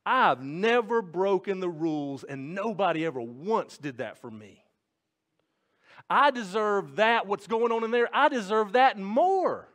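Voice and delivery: high, loud entitled protest; resentful older brother. Acted. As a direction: voice the older son's outrage, building up. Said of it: "He's outside going".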